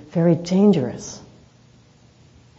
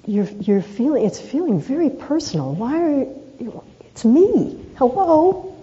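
rising retold plea; 8 semitones higher than usual. Pleading with angry colleague.

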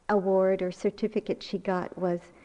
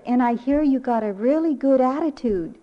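retold proud praise, louder and higher; excited praise; 4 semitones higher, 6 dB louder than usual. Grandmother praising granddaughter's award, excited praise. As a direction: lightly gush to a little child.

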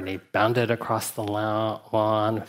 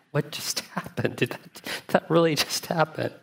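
shocked disbelief; 6 semitones higher than usual. Retold or imitated. Everyone in shock at the fall.

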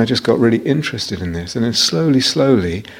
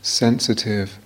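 calm meditation guide with long pauses between phrases; unhurried, spacious guiding, letting each phrase settle; guided meditation. Real, not acted. Teacher guiding retreatants into body awareness, calm guided meditation.

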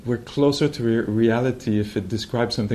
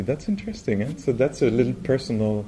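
ordinary talk

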